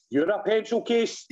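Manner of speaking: high mock kid retort; put-on high child's voice; cheeky child. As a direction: throw it back like a cheeky wee boy, pitched right up.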